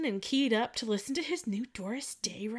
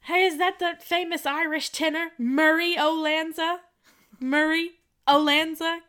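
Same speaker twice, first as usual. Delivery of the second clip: higher, louder corny dad joke voice; joking dad; 8 semitones higher, 8 dB louder than usual. Retold or imitated. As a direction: ham up the dad's pun. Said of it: "He says".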